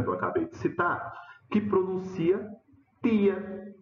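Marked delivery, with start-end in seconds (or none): 3.0-3.7 "tia" with a hard dental t, pitched high; pronúncia pernambucana. Shown as a demonstration. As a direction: Pernambuco "tia", like "tee-a" without ch. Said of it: "a variedade pernambucana". ("tia" pernambucano, com t seco)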